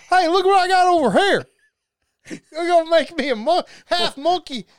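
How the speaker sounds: high, loud character voice; put-on comic accent. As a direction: crow about a wild scheme in a put-on voice. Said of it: "my accent for that is also".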